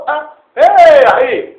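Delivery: high, loud "hey bro"; excited greeting. Acted. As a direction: greet your buddy with a burst. Acted friends greeting. (ברכה נלהבת וגבוהה)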